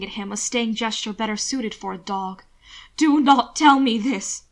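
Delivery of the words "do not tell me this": slow, loud and higher forbidding; commanding Francis not to go on; loud refusal. A narrator's reading. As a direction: order him to stop, slowly. Will refusing to hear.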